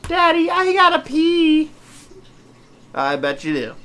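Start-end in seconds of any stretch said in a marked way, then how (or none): 0.1-1.7 put-on high whiny little girl; mock a whining small child. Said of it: "bladder of a three-year-old little girl".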